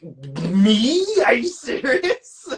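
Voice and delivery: high, drawn-out disbelief; mock incredulous. New Yorker answering Southerners' teasing, with high mock incredulity. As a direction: squeal it slowly in laughing disbelief. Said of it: "And I'm like".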